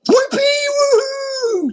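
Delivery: very high, slow whooping cheer. A narrator's reading. Yak cheering Abu's rescue, very high whoop. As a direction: whoop for joy. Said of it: "cheered the yak".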